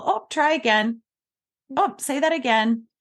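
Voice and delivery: high, lilting retry cue; breezy parent nudging a child to rephrase; changed lilt. Shown as a demonstration. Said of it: "change your lilt and your tone".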